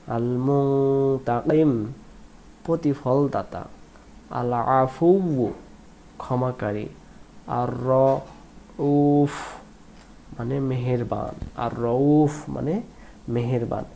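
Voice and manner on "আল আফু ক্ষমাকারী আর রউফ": usual speaking voice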